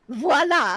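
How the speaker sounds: "Voila" said as vwah-LAH; correct French voila. Shown as a demonstration. Friend teaches the player the French word, "Voila" as vwah-LAH. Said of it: "just a little bit of French that everybody should know".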